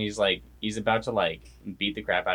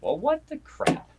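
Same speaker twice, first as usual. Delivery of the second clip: high-pitched mock disbelief; shocked retelling. Hero learns his dad killed her, mock shock. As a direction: imitate a stunned, much higher reaction. Retold shocked reply to bad news.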